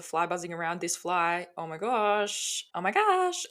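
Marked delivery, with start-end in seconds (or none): 1.6-2.6 drawn-out, slow exasperation at a fly; exasperated. Real, not acted. Fly buzzing around mid-recording. 2.7-3.5 real high squeak of annoyance; high-pitched exasperation. Real, not acted. Fly buzzing around mid-recording.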